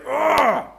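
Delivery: sudden high roar of rage, acted; estallido de ira. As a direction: burst out like the Hulk. De repente ira, Hulk saliendo. (rugido de ira fingido)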